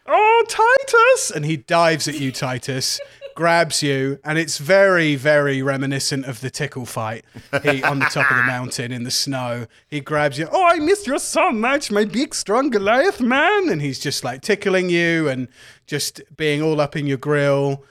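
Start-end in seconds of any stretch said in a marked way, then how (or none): 0.1-1.3 very high, loud, gushing flamboyant voice; Eriken's high gushing greeting. Acted. GM voicing Eriken greeting Titus in a high flamboyant gush. 10.5-13.7 very high, loud, gushing flamboyant voice; Eriken's high gushing greeting. Acted. GM voicing Eriken greeting Titus in a high flamboyant gush.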